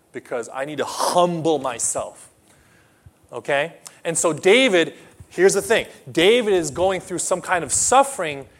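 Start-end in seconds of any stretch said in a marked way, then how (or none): none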